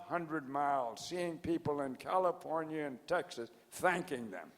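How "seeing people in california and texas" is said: ordinary talk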